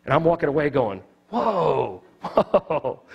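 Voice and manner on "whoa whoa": stunned whoa. Retold or imitated. Teller stunned walking away.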